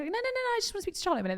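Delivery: high, rapid, imitated fobbing-off; hurried cover-up. Team member fobbing off the suspicious bride, hurried and evasive. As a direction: rattle it off high and soft, mock-evasive.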